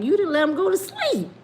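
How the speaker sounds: high-pitched, disbelieving retold rebuke; incredulous reproach. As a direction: act out a friend's amazed complaint. Kango scolds her in disbelief.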